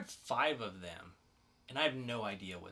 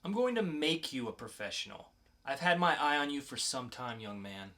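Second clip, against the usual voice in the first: lightly voiced calm interrogator, cool and in control; 6 semitones higher, 5 dB louder than usual. Podcast host reads the mysterious agent to a captured teen, cool and controlled.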